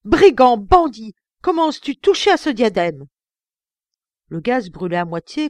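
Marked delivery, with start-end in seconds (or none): 0.0-3.1 loud, higher accusing yell; furious shout. Acted. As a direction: hurl the insults at the thief. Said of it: "criai-je".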